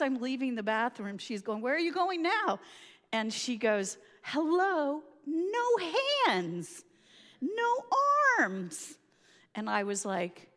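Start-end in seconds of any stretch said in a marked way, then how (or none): 1.6-2.6 high, louder call from the next room. Retold or imitated. Friend calling after her from the bathroom, raised voice. 4.2-9.0 high, punchy no with dropped hands and arms; sarcastic duh. Retold or imitated. Friend reminding her she has no arms, sarcastic.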